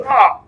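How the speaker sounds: a short high yelp of pain, imitating the character; a short, high cartoon cry; mock pained cry. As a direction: let out a quick hammed-up "Ah!" as he gets frozen. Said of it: "and then he goes".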